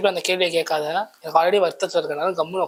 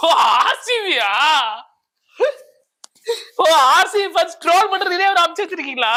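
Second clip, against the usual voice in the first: laughing realisation; 8 semitones higher, 3 dB louder than usual. Acted. Laughing realisation of RCB trolling.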